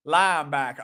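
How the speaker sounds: low, broad fake Southern accent; hammed-up Southern linebacker. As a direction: try it again as a Southerner. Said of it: "do it with a southern ac".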